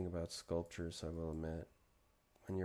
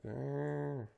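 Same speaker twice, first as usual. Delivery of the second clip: grumpy grumble; 8 semitones higher, 4 dB louder than usual. Retold or imitated. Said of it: "it's like".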